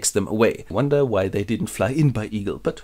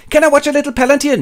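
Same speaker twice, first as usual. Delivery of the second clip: put-on much higher and fast, eager kid; mock childish plea; 6 dB louder, faster than usual. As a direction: beg like a hyper child. Pippin pleading.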